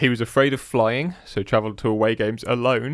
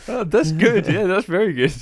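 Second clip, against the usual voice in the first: laughing praise; 8 semitones higher, 4 dB louder than usual. Real, not acted.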